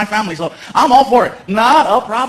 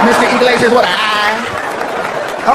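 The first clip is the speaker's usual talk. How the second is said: slow, stretched sassy taunt; drawn-out sass; much slower than usual. Retold or imitated. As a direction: drag out every word to mock him.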